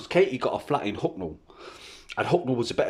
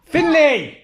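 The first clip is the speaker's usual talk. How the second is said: loud high shout of a name; calling out; 13 semitones higher, 9 dB louder than usual. Real, not acted. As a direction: parent calls Finley loudly to stop him. Calling child.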